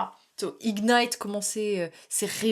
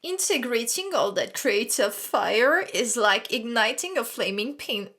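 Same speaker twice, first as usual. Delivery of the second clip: slow card reading in a French-accented English; slow, careful English with a French accent; slower than usual. Shown as a demonstration. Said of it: "j'ai envie de me frapper mon accent".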